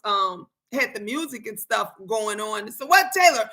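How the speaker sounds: ordinary talk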